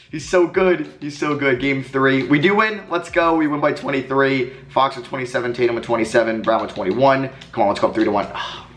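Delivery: normal talking voice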